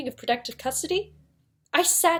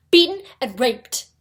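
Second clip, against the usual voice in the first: suddenly much louder, words hit hard; outraged emphasis; 11 dB louder than usual. Acted. Lawyer telling of the assault on Angel, forceful. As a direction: punch out each blow in outrage.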